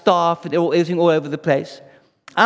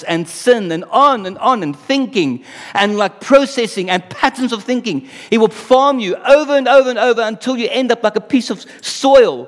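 forceful preaching; 3 semitones higher, 4 dB louder than usual. Real, not acted. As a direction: build the warning, hammering each repetition. Preaching builds to forceful climax.

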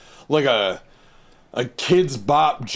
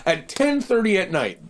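higher, incredulous rant; baffled; 8 semitones higher than usual. Retold or imitated. Rewinding the episode, incredulous mock rant. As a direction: pile up questions in disbelief. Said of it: "I'm like".

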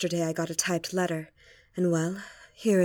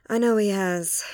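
normal talking voice

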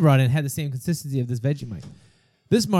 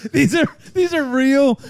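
high, slow words through real laughter; laughing; 9 semitones higher, 3 dB louder, slower than usual. Real, not acted. Host watching the taster retch, laughing. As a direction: struggle to talk through the laughs.

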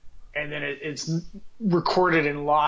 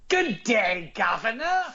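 British accent attempt; 5 semitones higher, slower than usual. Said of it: "I'd do some sort of accent".

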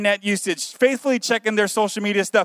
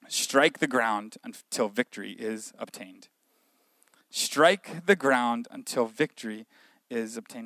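scripture reading; 9 semitones lower, 8 dB quieter, slower than usual. Acted. Reads the scripture verse.

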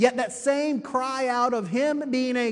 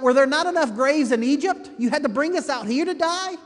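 louder, higher mock protest; imitated complaint, pitched up and projected; retold crying out; 6 semitones higher, 6 dB louder than usual. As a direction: cry out as an indignant crowd, preacher-style. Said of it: "they cry out".

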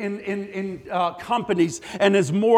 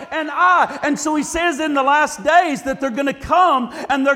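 preaching at full force; 3 semitones higher than usual. Acted. Preacher driving the point home to his congregation, at full force. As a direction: preach it harder and harder.

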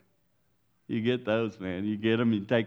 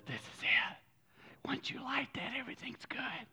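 whispered; 12 semitones higher, 11 dB quieter than usual. Retold or imitated. Whispered voice of false hope.

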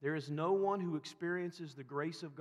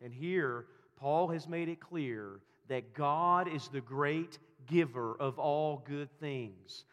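ordinary talk

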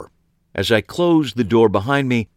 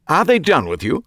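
higher, a little out of breath; breathless; 8 semitones higher than usual. A narrator's reading. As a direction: ask it short of air, eager. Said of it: "he asked breathlessly".

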